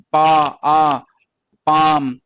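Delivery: पा, आ, पाम each slowly stretched on आ; long vowel. Shown as a demonstration. Teacher showing the आ sound inside words, long vowel. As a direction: hold the आ in पाम. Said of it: "आ की sound आ रही है इसमें".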